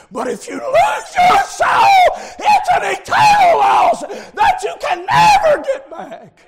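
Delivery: high, loud revival preaching; full-throated, urgent sermon shout; preaching at full force. Real, not acted. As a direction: shout the warning at the sinner.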